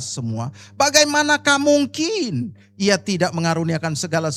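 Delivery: loud, declaiming Bible reading with rising fervour; fervent scripture reading. Acted. Preacher reading Romans to the congregation, loud and fervent. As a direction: proclaim every question as a challenge to the church.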